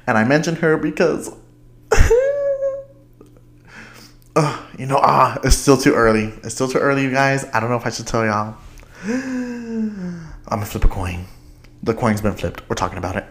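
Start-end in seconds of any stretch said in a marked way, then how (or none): 1.9-2.8 quick excited giggle; high giggle. Real, not acted. Giggling about a secret. 8.8-11.3 gasp, then slow and playful. Real, not acted. Gasps, playful suspense.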